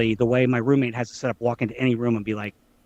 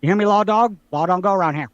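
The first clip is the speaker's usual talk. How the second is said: higher, louder quoted film villain; movie quote; 6 semitones higher, 5 dB louder than usual. Acted. Friend quoting Ike Clanton to the lawman, raised voice. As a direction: call it out as a movie challenge. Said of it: "I can pull a movie quote".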